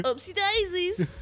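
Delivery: high, cutesy oops voice; mock baby talk. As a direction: coo it like a sorry toddler.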